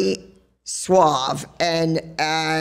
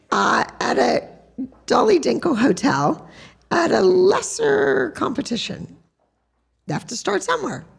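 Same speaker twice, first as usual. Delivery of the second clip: put-on higher, lofty dismissal of her lesser kids; mock haughty self; 8 semitones higher than usual. Higher, lofty brag to John about her other kids. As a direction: lift the pitch and look down your nose. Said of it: "I said".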